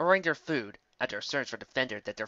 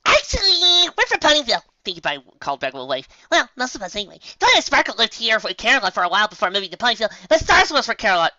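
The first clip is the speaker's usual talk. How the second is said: high, loud bubbly voice; Pinkie cheerful; 9 semitones higher, 11 dB louder than usual. Acted. Pinkie reassuring Galahad, high and loud. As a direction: bounce it out brightly.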